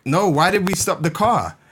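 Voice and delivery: a hammed-up lost student. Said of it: "He's like".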